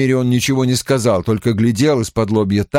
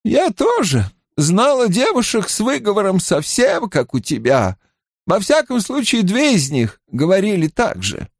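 higher-pitched woman's character voice; pitched-up, lightly acted older woman; weary healer woman; 9 semitones higher than usual. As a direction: recall it as a tired matron. Narrator voices grey-haired woman.

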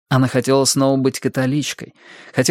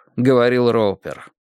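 ordinary delivery

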